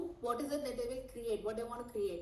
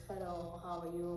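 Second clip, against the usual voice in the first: softer, lifeless hello; flat dead tone; 3 semitones lower, 5 dB quieter than usual. Shown as a demonstration. Coach showing a flat, tired client. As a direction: say it like you had a tough day. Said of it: "flat tone, like, dead and out tone".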